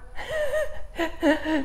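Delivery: a burst of high laughter; a high, real laugh. Real, not acted. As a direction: laugh at your own swearing. Laughs after cursing the hill.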